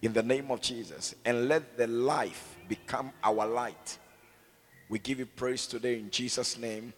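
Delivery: loud, fervent proclamation; preaching. Acted. Pastor rousing the congregation, loud and fervent. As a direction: declare it to the whole church. Prayer builds to loud declaration.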